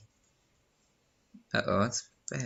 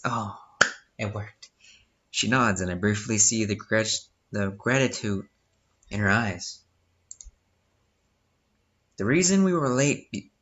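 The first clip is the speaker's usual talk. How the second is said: ordinary talk, nothing marked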